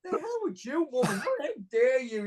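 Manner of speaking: mock-outraged, high-pitched protest; wounded vanity. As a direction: play offended pride, half laughing. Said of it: "my vanity kicked in".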